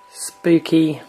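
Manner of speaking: ordinary talk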